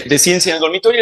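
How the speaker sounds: normal talking voice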